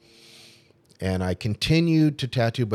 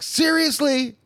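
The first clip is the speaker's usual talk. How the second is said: much higher, incredulous squeak; retold tearful disbelief; 4 dB louder, slower than usual. Replaying her reaction after being rejected for being too good, high and incredulous. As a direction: shoot the voice up in hurt disbelief. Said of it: "So I was crying for a block".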